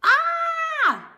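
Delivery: very high, loud shouted ah. Acted. Froggy shouts ah for the doctor. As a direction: belt out the ah to the doctor. Frog opens mouth for doctor.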